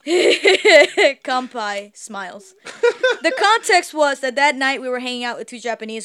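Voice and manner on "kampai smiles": words squeezed out through laughter; laughing. Real, not acted. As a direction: laugh your way slowly through it.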